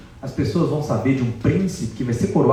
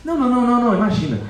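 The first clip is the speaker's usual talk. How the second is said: higher, flustered refusal of praise; embarrassed Hanuman; 13 semitones higher, 3 dB louder, much faster than usual. Acted. Hanuman shrugging off Sita's praise, bashful and flustered. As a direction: brush off the compliment, flustered. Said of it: "fica ultra sem graça".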